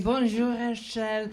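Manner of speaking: imitated French greeting; French accent. French people greeting the comedian, French accent. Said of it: "everyone going".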